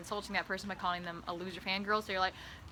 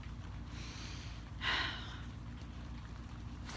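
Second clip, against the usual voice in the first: long mournful sigh; heavy mock sigh; 12 dB quieter than usual. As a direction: sigh theatrically. Tragic sigh.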